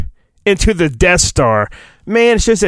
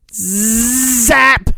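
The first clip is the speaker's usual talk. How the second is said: zap sound effect; 6 semitones higher than usual. Acted. Force lightning sound effect.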